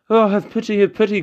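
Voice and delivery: high, loud desperate plea; pleading cry. Acted. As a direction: beg for your life. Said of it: "cried the heron".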